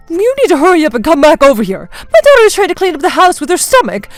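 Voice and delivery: loud, high-pitched demanding complaint; complaining. Acted. Mother-in-law orders daughter-in-law over to clean, loud complaining. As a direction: nag her insistently down the phone. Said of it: "instantly started to complain to me".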